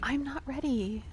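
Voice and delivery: soft breathy whisper, slow. Pretend. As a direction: whisper it back to her. Whispers back to game character.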